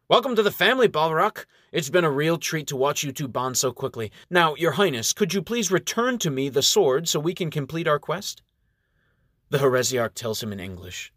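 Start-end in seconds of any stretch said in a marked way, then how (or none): 0.1-8.4 high, bright-eyed welcome; cheerful announcement. Acted. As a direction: sweep in with forced cheer. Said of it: "announces cheerfully".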